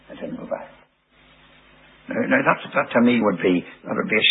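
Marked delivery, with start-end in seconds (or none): 0.1-0.7 near-whispered repeat, much softer; whispered echo. Retold or imitated. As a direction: breathe it out like an afterthought. Echo of the previous line.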